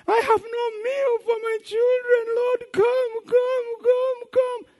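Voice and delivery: very high, crying plea; hammed-up sobbing prayer; tearful desperate prayer. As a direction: cry out to God for food. Hungry woman crying to God.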